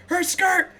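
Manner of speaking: very high-pitched imitation of an appalled teacher; mock scandalized cry. Teacher spotting a girl's skirt, high mock-scandalized cry. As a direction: squeal it in mock outrage.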